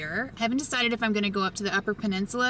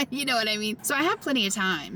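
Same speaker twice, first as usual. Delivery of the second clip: ordinary talk, nothing marked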